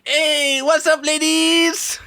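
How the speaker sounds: loud-ish, drawn-out, high hammed-up greeting; mock ladies' man. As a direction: ham up a slow come-on to the ladies. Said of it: "he looks like he's got a really deep voice".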